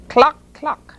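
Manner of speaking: high, short hen clucks; clucking hen. A narrator's reading. Hen gets her corn at last, high clucks. Narrator voices the pleased hen.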